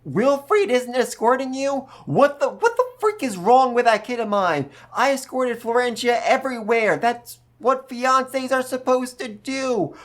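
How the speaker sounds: a dad losing his mind over his son; outraged father. Retold or imitated. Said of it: "loses his goddamn freaking mind".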